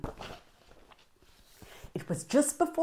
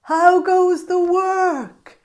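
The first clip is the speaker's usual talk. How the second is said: high, loud, slow bossy call; lazy farmer calling out to the duck, high and loud; 11 semitones higher, 11 dB louder, slower than usual. Acted. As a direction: call down lazily from bed. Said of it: "he called".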